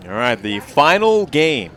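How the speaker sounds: loud rising player introductions; announcer call. Real, not acted. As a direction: boom out the names. Announcing final game lineup.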